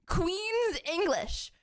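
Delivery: slow, careful Queen's English, every syllable crisp; posh Queen's English. Shown as a demonstration. Showing chat how Queen's English sounds, slow and proper. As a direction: savour each syllable in a posh British accent. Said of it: "you pronounce all the syllables clearer".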